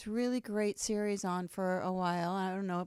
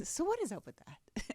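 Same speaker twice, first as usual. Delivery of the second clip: higher, upbeat show-title delivery; own catchphrase delivery; 6 semitones higher, 5 dB quieter than usual. Shown as a demonstration. Host showing how she says the show's name, higher and lighter. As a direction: pitch it up, lightly, like a show opener. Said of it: "And I say it like that".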